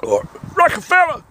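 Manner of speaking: high-pitched shouted exclamation; mock hype shout. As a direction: yell it out like a hype man. Mock hip-hop label shout-out.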